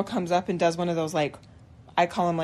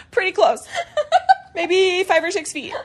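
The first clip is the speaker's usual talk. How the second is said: laughing; 10 semitones higher than usual. Retold or imitated. Admitting to husband, laughing.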